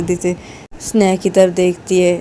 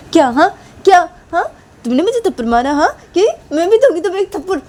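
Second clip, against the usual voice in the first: louder, much higher, fast threat; angry threat; 11 semitones higher, 4 dB louder, faster than usual. Acted. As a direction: snap back and promise a slap. Sneha threatens slap back.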